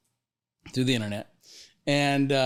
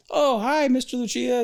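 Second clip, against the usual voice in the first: high polite greeting; 10 semitones higher than usual. Retold or imitated. Client greets the boss on camera, a high mock voice. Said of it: "he says".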